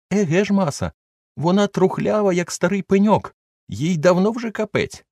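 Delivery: high, sheepish servant excusing himself; Jupiter's voice. A narrator's reading. Old servant brushes off fear of the beetle, high and sheepish. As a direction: pitch up as the scolded old servant.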